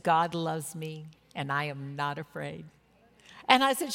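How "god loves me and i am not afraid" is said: low, soft, measured testimony; quiet assurance. Retold or imitated. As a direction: gently voice a dying friend's calm faith. Dying woman's quiet testimony retold.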